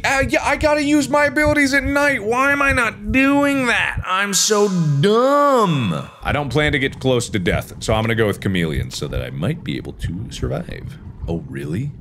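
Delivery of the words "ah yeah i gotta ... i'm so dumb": exasperated self-scolding. Real, not acted. Gamer kicking himself over forgotten night abilities, exasperated high wail.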